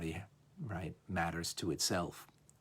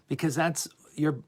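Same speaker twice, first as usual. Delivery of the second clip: usual speaking voice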